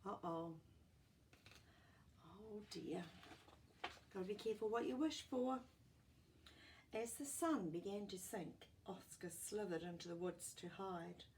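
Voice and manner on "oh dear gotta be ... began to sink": plain reading voice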